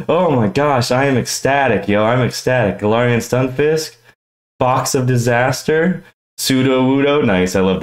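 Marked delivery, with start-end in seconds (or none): none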